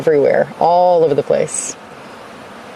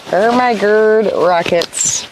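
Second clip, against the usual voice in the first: playful hammy voice; 6 semitones higher, slower than usual. Pretend. Playful pep line.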